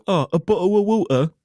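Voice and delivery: exaggerated British accent. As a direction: send up a bad British impression. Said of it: "when people go".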